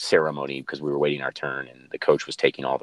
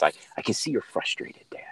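soft calm whisper, son soothing his dad; a son whispering calmly to his frustrated father; imitating son's whisper; 4 semitones higher, 6 dB quieter than usual. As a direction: breathe out the words, hushed and gentle. Son calmly voiced in story.

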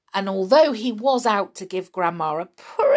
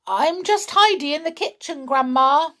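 innocent call; 9 semitones higher, 4 dB louder than usual. A narrator's reading. Boy calls back innocently.

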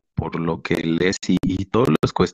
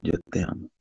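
softly repeated declaration; inner voice, hushed; 7 dB quieter, faster than usual. Retold or imitated. Demonstrating how the voice inside him spoke, hushed. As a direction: imitate a hushed voice from within. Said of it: "Así decía".

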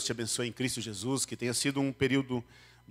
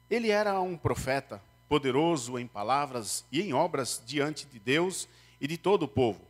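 higher, solemn Bible reading; scripture reading; 4 semitones higher than usual. Acted. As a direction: read the passage to the congregation. Reads the Bible passage aloud. (leitura bíblica solene)

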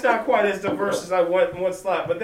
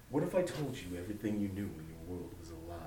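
much lower and softer, fast, deep mock-oracle voice; Morpheus; 12 semitones lower, 16 dB quieter, much faster than usual. Redoing the co-host's Morpheus line in a low, quiet voice. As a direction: lean in low like a mysterious mentor. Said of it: "the guy who played, um, Morpheus".

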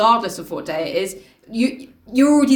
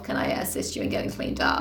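whispering; 8 dB quieter than usual. Pretend. Mock polite housekeeper.

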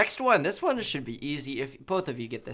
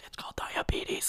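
whispering; 7 dB quieter than usual. Real, not acted. Joking aside about eating.

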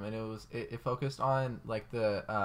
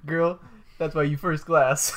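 louder, punchy sassy comeback; sassy loud retort; 5 semitones higher, 11 dB louder, slower than usual. Retold or imitated. Telling a friend the joke, sassy loud retort. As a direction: hit it with sass, loud and slow. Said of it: "and I go".

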